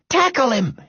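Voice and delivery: high-pitched urgent yell. A narrator's reading. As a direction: yell an order across the yard. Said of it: "yelled Robert".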